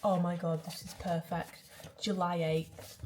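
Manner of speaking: ordinary talk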